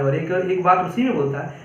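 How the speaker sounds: usual speaking voice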